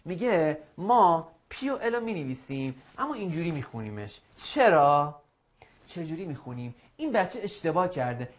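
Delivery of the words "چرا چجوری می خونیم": normal talking voice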